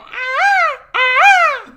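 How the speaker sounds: shrill screeching bird woman. Pretend. Imitating peacock screech.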